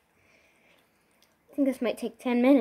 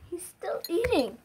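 ordinary delivery

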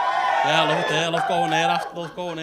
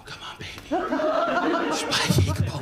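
breathy camp coax; 10 semitones higher, 4 dB louder than usual. Retold or imitated. Seller coaxes breathily.

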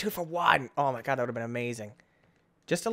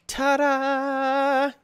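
triumphant; 10 semitones higher than usual. Real, not acted. Triumphant game moment.

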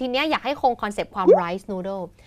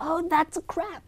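movie line; 8 semitones higher, 4 dB quieter, much slower than usual. Acted. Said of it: "ถ้าดูหนังเราก็อาจจะเจอคำว่า".

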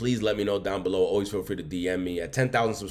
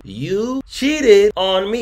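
outraged shout; 11 semitones higher, 10 dB louder, much slower than usual. Real, not acted. Ranting about cheating.

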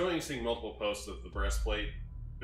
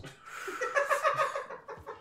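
breathless shrieking laugh; high wheezing laugh. Real, not acted. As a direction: burst out in a squealing laugh. Reacting to joke.

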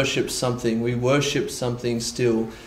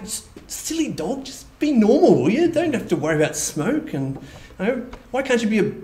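high, coaxing voice to a silly dog; pleading with a pet that won't behave; talking to the dog; 8 semitones higher than usual. Retold or imitated. Scolding his dog about smoke.

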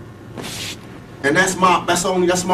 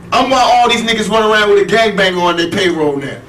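heated rant; 5 semitones higher than usual. Real, not acted. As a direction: vent hard, voice raised high.

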